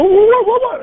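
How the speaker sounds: high, loud startled what. Retold or imitated. Client jolted by the yell, loud startled what. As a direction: blurt it out jolted. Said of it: "they jump ten feet in the air".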